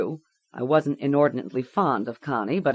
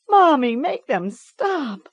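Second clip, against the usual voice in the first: put-on high, slow pleading child; begging child; 11 semitones higher, 6 dB louder, slower than usual. A narrator's reading. Girl begs her mother to end it, slow plea. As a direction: plead with your mother, slowly.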